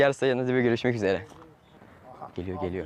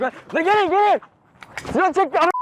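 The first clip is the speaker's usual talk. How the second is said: high panicked yell for help; shouting for help; 13 semitones higher, 4 dB louder than usual. Real, not acted. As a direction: call out loud to friends. Calling friends for help.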